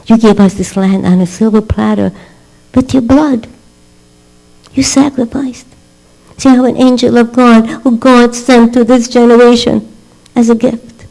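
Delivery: high, fervent, ringing sermon voice; preaching at full force. Retold or imitated. Rebbetzin exalting the soldier as an angel, fervent. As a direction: lift it to a passionate peak.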